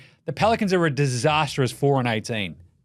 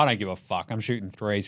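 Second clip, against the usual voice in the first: jugador indiferente; 7 semitones lower, 6 dB quieter than usual. Acted. Acted defiant player.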